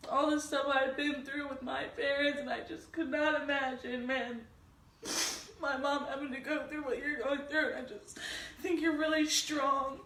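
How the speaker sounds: tearful, breaking high voice; crying while speaking; ugly crying. Real, not acted. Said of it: "I'm crying".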